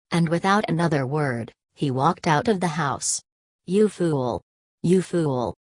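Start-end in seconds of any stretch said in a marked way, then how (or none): 3.7-5.5 a bitter, lightly raised cry of "fool"; a read-aloud father's bitter insult, not shouted; extreme bitterness. A narrator's reading. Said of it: "with extreme bitterness".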